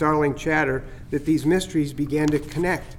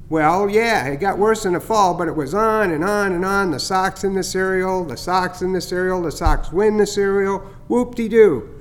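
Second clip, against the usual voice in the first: teasing starling; 3 semitones higher than usual. Acted. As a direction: gloat a little over the fake heron scare. Chatter mocks the fuss.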